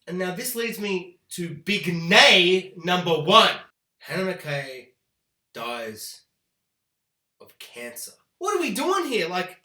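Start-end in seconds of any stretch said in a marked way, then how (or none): 4.0-8.1 much lower, much softer deflated reveal; dropping, fading letdown. Real, not acted. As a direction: let it sink with disappointment.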